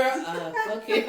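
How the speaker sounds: ordinary delivery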